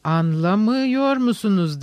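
reproachful. A narrator's reading. Smerdyakov reproaching Ivan from above, slow and reproachful. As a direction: draw it out slowly as a reproach. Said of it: "sitem dolu bir sesle".

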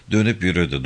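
ordinary delivery, nothing marked

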